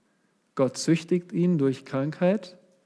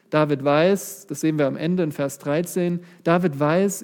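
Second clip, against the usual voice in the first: ordinary talk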